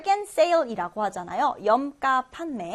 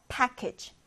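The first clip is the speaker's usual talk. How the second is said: "package" with PACK stressed; stress on first syllable; 5 dB quieter than usual. Shown as a demonstration. Teacher models a new word, "package" stressed on the first syllable. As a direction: hit PACK in "package", drop off on the ending. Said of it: "첫 음절의 강세를 두고요".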